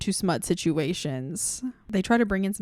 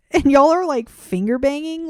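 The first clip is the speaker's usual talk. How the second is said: louder, higher, indignant shout; incredulous shout; 6 semitones higher, 8 dB louder than usual. Retold or imitated. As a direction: yell at the book in disbelief. Peak of incredulous rant.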